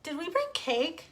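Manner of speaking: put-on high, eager child voice; a small girl calling her father; child asking her daddy; little girl. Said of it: "Clara repeated".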